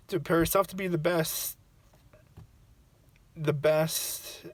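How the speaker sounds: choked up and halting, voice lifted; crying. Real, not acted. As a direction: struggle to get the words out through tears. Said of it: "right now when I'm crying".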